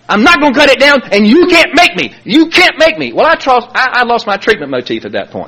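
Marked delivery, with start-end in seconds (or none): 0.1-3.1 higher-pitched imitation of a defiant yell; mock a son yelling back, insistent on each phrase; retold yelling. Son yelling at his father over the TV, retold yell. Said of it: "And he was yelling".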